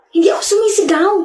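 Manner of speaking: high, indignant protest. Acted. Upset girl denying she shouts, high defensive protest. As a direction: protest shrilly that all is fine. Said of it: "Ang tawag mo dyan".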